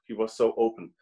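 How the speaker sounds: ordinary talk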